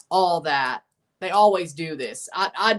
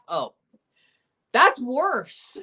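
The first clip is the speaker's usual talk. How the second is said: dismayed outburst; 7 semitones higher, much slower than usual. Real, not acted. Groans at referee assignment.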